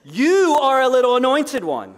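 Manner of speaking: preacher declaring identity to the congregation; serious proclamation. Real, not acted. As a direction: press every word home. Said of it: "this is serious, y'all".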